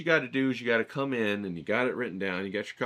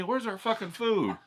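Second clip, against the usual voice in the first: laughing raven voice; 11 semitones higher than usual. Retold or imitated. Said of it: "they're looking at me".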